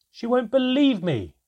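loud, high protesting shout. Acted. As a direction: burst out that nobody will believe you. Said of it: "I wanted to shout".

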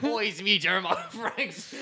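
cheeky Aussie bird introducing himself; mock Australian. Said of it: "he's Australian".

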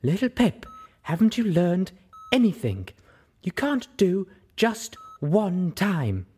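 high cross scolding of a friend; scolding Velvet. Acted. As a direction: drive the lesson home, cross.

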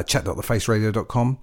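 ordinary talk

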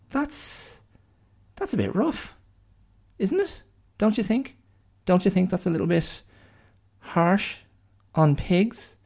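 high, incredulous protest; high-pitched mock outrage; mock indignation. Real, not acted. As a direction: plead the pigs' case in disbelief. Mock outrage at pig fate.

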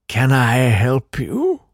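slow, drawn-out question swooping up on YOU; creepy Count. Acted. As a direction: stretch it out like a spooky host. Redoes the Count's creepy greeting.

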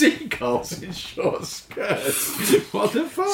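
laughing through the words, high; giggling in disbelief. Real, not acted. Laughing at the image.

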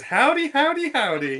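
high, loud howdy dropping into laughter; mock cowboy captain. Player mimicking the captain smelling burnt Apari, mock cowboy. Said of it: "she just goes".

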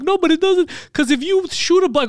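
squeaky high mock voice of the tester; high mock coworker protesting. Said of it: "looking at me, he's like".

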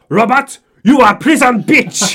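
high, full-volume shout across the kitchen; top of his voice. Retold or imitated. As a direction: bellow it at the manager. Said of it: "says at the top of his voice".

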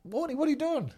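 high, astonished imitation of a teammate; mock surprise. Teammate catching Warnie with a beer, mock shock. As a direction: squeak it out like a shocked mate catching him. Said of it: "walk in and go".

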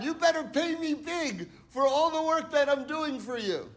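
high-pitched indignant demand; hammed-up complaint hurled at God; mock angry. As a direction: act out an aggrieved worker demanding payment. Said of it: "they get angry at God".

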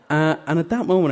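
ordinary delivery

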